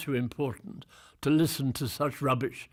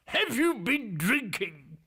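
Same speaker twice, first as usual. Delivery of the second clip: high, indignant boss's question; authoritative boss; 9 semitones higher than usual. Retold or imitated. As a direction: imitate an affronted man in charge, pitched far up. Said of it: "one of those very authoritative voices".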